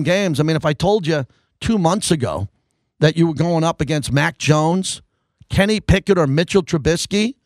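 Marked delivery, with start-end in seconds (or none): none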